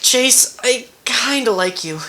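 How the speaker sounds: high-pitched, put-on character voice; high, slowed character voice; Leo. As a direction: lift it high and draw out the confession. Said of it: "and then for Leo, I'll go".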